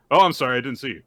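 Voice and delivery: sincere, slightly startled sorry; earnest, unforced acted apology; legit apology. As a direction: apologize simply, as an honest accident. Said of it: "Very legit".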